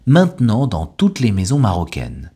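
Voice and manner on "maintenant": "maintenant" as main-t'nant, T kept; silent E. Shown as a demonstration. Teacher gives learners a word to repeat, "maintenant" with silent E. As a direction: model "maintenant" in two syllables without the middle E. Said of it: "Le E de maintenant a complètement disparu".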